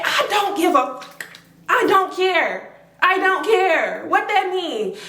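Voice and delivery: high, slowed mock rant; furious dismissal. Mika brushing off Cece's excuse, high slow mock fury. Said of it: "She said".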